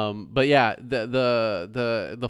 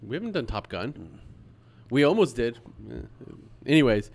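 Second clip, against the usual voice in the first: usual speaking voice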